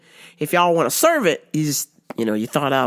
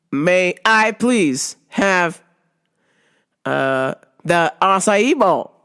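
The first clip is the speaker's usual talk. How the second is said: exaggerated slow, careful ordering; overly careful, slowed-down request; slowly; much slower than usual. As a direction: spell it out slow as if for a lip reader. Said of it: "saying it slowly".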